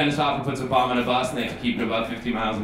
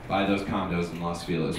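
ordinary talk, nothing marked

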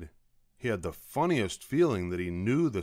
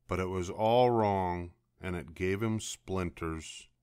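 plain reading voice

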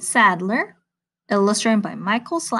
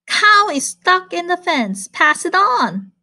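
higher, urgent bee message; urgent little bee; 8 semitones higher than usual. Acted. Bee telling frog about cow, higher urgent plea. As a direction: pass the news on like a worried bee.

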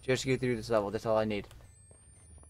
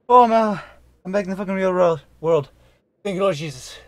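relieved excitement; 4 semitones higher, 7 dB louder than usual. Real, not acted. Escaped, relieved.

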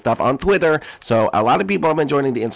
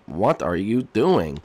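usual speaking voice

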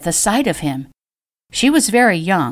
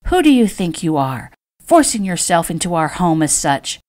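ordinary delivery

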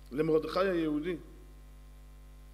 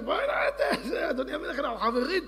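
much higher, teary mock sobbing; hammed-up crying plea; 12 semitones higher, 5 dB louder than usual. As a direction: Haman whines and begs the king through fake tears. Haman begs king, crying. (תחינה בוכה ומוגזמת)

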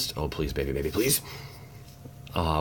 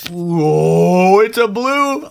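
excited shout; 12 semitones higher, 12 dB louder, much slower than usual. Real, not acted. Excited pack opening reveal.

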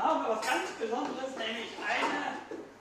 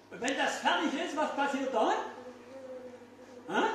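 usual speaking voice

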